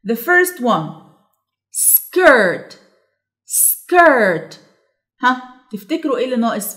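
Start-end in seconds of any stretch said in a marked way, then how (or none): 1.7-4.6 "Skirt" loud, with separated s and stretched falling vowel; dictation word. Shown as a demonstration. Teacher dictating for listen and complete, emphatic drawn-out word. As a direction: sound out "Skirt" so the letters are heard. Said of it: "هنسمع ونكمل".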